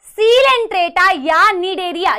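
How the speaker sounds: "सीलेंट्रेटा" loud, with a long first syllable; teacher calling out "सीलेंट्रेटा" to be copied; say-along pronunciation. Shown as a demonstration. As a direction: clearly model "सीलेंट्रेटा या नीडेरिया" for the class. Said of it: "मेरे साथ साथ प्रोनंशिएट करो".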